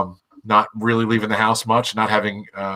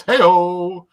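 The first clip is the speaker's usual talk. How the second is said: comic hey-o; 8 semitones higher, 4 dB louder than usual. Real, not acted. Comic exclamation.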